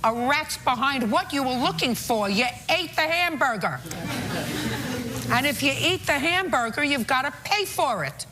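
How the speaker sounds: high-pitched stern scolding; scolding judge. Real, not acted.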